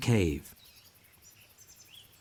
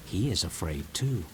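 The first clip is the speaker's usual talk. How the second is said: usual speaking voice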